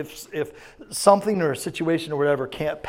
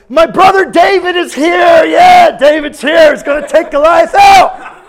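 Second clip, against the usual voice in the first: much higher and much louder, booming big-brother cheer; proud cheering shout; 18 dB louder, slower than usual. Pretend. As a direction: shout it out for your little brother. Imagined Eliab cheering for David.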